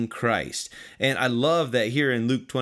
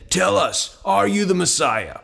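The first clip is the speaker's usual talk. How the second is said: raised-pitch, pressing question; demanding interrogation; 7 semitones higher, 5 dB louder than usual. Acted. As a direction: demand an answer from the prisoner. Council interrogates Jesus.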